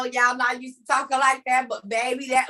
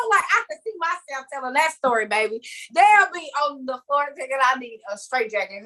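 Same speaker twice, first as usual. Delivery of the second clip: ordinary talk, nothing marked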